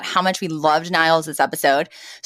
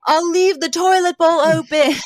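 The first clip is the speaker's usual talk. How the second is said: higher, retold call-out; mock yell; 9 semitones higher than usual. Niles yelling a last insult after Cece, higher mock yell. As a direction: fling it after her down the hall. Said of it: "he yells".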